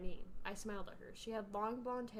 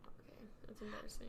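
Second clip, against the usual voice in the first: whisper; 8 dB quieter than usual. Real, not acted. Embarrassed admission.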